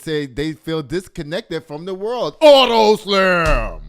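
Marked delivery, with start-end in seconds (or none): 2.4-3.8 slow, drawn-out booming shout, much louder and higher; catchphrase shout. Acted. Host announcing his Auto Slam segment, booming drawn-out shout. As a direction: belt out the segment name like a hype announcer.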